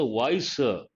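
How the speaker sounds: normal talking voice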